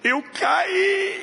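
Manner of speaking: put-on high, shouted small child; little boy's voice. Little son answers mother.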